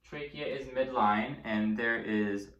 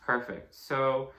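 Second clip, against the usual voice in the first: normal talking voice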